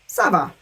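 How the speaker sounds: falling, settled "Ça va"; answer intonation. Shown as a demonstration. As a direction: answer "Ça va" with a dropping tune. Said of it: "say it as if it's an answer".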